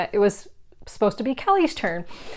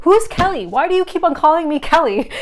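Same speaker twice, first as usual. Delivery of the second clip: higher, louder, incredulous asking; baffled question; 7 semitones higher, 5 dB louder than usual. Retold or imitated. To Bruce, confused why he keeps saying Kelly, incredulous. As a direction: ask it genuinely baffled.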